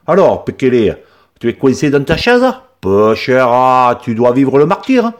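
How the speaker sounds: put-on plus haut, plus fort, accent du Midi compatissant; le paysan provençal plaint l'enfant; fort accent méridional. A narrator's reading. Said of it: "avec un fort accent méridional". (accent du Midi compatissant)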